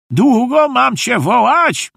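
lightly voiced exasperated grandpa, pitched high; puffing grandpa. A narrator's reading. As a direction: huff it as an impatient grandfather. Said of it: "wysapał dziadzio Feluś". (lekko zagrany zniecierpliwiony, sapiący dziadek)

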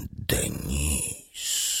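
soft breathy whisper of her name. A narrator's reading. Whispering her own name to the mirror, hushed. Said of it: "wyszeptała".

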